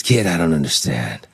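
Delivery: low, confiding imitation of a veteran actor; Burt Reynolds. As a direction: do a low-voiced old-school star taking the kid aside. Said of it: "So he goes".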